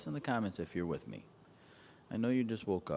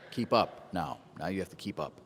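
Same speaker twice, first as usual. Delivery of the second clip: loud; 8 dB louder than usual. Real, not acted. Teasing viewers to keep up.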